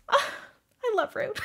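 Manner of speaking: squealed high with delight; fangirling. Real, not acted. Fan gushing over Rune, high and delighted. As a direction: gush over a favourite character. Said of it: "I'm done fangirling".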